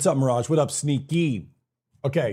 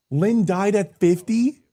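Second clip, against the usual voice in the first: shocked disbelief; 8 semitones higher, 3 dB louder, slower than usual. Real, not acted. Repeats in disbelief.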